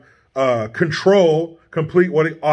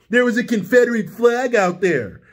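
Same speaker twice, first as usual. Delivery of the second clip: higher-pitched mock politician; mock a clueless American suit, pitched up; empty-suit politician; 4 semitones higher than usual. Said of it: "some American empty suit dumbass would say".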